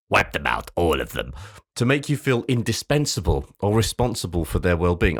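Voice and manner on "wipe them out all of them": Darth Sidious. Acted. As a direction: intone it like an evil emperor. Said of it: "like fucking Darth Sidious".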